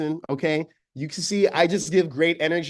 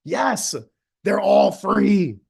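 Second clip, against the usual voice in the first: normal talking voice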